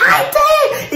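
high mock child wail; a boy's tantrum; imitating a child's cry. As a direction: cry it out like a furious little boy. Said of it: "il dit, «Mais si".

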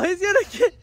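high wheezing laugh through words; laughing hard. Real, not acted. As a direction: crack up while talking. Laughing as cow chases.

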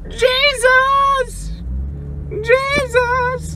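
high shocked shriek; startled scream. Real, not acted. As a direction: shriek in fright.

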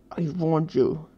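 an angry warning, hissed with restraint. A narrator's reading. Danny warns the boy he pinned down, hissing. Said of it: "he hissed".

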